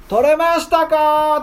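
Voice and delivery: high, slow, gentle call from the shore; stranger calling from shore. Acted. Jesus on the shore calls to the tired fishermen, high and slow. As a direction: play the man on the beach asking kindly. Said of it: "とか言って聞いてきた".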